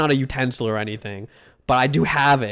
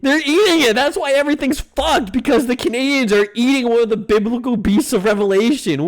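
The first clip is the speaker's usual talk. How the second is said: loud, high ranting incredulity; mock outrage; 8 semitones higher, 4 dB louder than usual. Streamer ranting at what Canadians are eating, in mock outrage. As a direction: rant it high and forceful in hammed-up disbelief.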